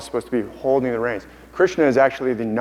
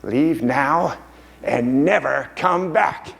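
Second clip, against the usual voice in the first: slow, put-on voice banishing someone; Smeagol; much slower than usual. Smeagol banishing his alter ego, slow acted command. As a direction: draw out the command in character. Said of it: "And then Smeagol says".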